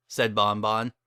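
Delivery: ordinary delivery, nothing marked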